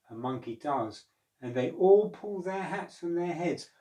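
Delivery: lively storybook read-aloud; reading to children. A narrator's reading. Teacher reading an Indian folk tale to pupils, lively. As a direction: tell it like a teacher to a class.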